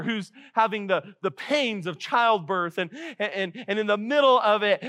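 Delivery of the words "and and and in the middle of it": usual speaking voice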